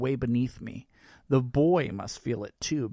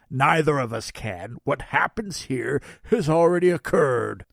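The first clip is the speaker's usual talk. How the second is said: Santa voice; 7 dB louder than usual. Acted. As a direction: play a cheerful Santa. Santa speaks.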